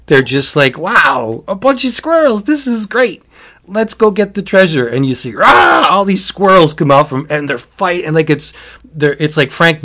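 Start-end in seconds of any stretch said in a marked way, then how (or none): 0.8-4.9 high, wide-eyed wonder at a lovely find; mock-delighted exclamation, pitched high; naive excitement. Naive orcs delighted by squirrels. 5.4-5.9 loud imitated squirrel battle cry; sudden loud "rah" of a swarm attacking; attack roar.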